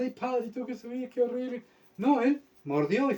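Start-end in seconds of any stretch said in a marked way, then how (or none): 0.0-1.6 high, small mock whine; llanto fingido. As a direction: whimper in parody, pitched up and softer. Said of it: "Él no iba llorando". (lloriqueo burlesco, agudo y bajito)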